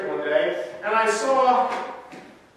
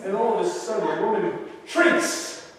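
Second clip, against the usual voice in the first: ordinary talk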